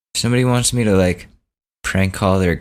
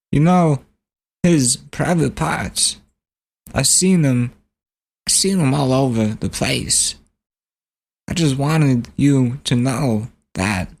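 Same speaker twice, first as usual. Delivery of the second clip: Christopher Walken; 4 semitones higher than usual. Retold or imitated. Prank caller to campaign staffer, celebrity impression. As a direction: stretch each phrase out, high and oddly halting. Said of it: "Christopher Walken".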